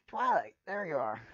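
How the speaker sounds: loud excited call across a crowd. Acted. Spike calling to Twilight across the party, loud. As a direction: shout her name from a distance.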